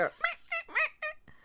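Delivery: high, soft meowing; mock cat meows. Squirrel imitated meowing at dogs, high meows. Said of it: "He'll go".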